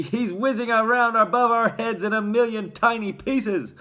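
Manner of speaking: high, loud outburst; exasperated father. A narrator's reading. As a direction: rant it loudly at your wife. Father answers frantic mother.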